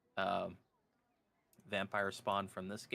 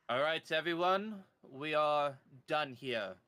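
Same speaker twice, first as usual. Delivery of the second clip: calling to troops; 7 semitones higher, 7 dB louder than usual. Acted. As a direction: announce it to a platoon behind you. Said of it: "he calls back to the platoon behind him".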